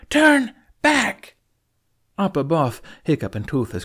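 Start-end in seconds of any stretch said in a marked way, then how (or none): 0.1-1.4 high, loud holler. Acted. Astrid pleading with the fleet, high loud holler. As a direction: call it out across the water at full voice. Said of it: "Astrid hollered".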